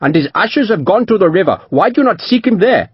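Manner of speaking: fierce declaration. A narrator's reading. Merchant fiercely telling the captain the man is dead. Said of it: "Panjit declared fiercely".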